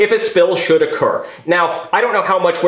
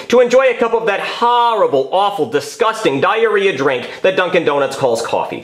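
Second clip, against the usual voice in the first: usual speaking voice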